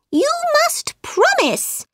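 very high, insistent little mouse; squeaky mouse. A narrator's reading. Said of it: "His voice was high and squeaky".

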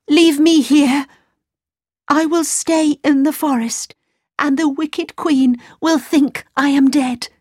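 put-on high, pleading girl; young girl voice.

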